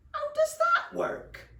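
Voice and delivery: very high, loud, slow squawk. Acted. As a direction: squawk it in outraged disbelief. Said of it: "squawked Seepage".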